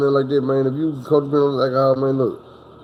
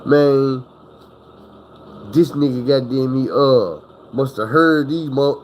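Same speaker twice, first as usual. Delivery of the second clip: ordinary talk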